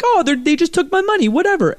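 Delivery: high, breezy don't-care voice; mock nonchalant. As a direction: shrug it off lightly. Mock shrug at losing money.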